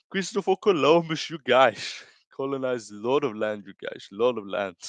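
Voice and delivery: put-on Brazilian Portuguese accent with slushy S sounds; Pantoja impression. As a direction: ham up a Brazilian English accent. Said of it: "It's my Pantoja impression".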